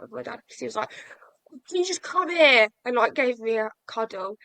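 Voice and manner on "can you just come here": imitating dad, higher and louder with feeling; emotional dad. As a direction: play the tearful dad calling his son in, pitched up. Dad voiced, tearful moment.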